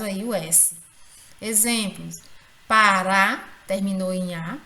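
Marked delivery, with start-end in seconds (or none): 2.7-3.4 pará loud, RÁ punched and held; stress on last syllable. Shown as a demonstration. Teacher showing an oxítona, pará stressed hard on RÁ. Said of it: "é a sílaba mais forte".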